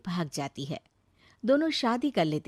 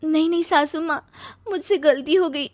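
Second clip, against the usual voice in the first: higher, desperate plea; begging; 8 semitones higher, 4 dB louder than usual. Acted. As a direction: beg the mother-in-law for mercy. Neeru begs forgiveness.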